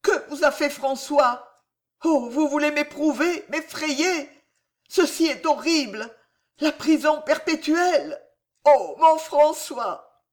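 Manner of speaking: desperate, begging him to say it is a test; horrified plea. Acted. Mother learns her lover faces life in prison, a high horrified plea. Jeanne horrified by the arrest order.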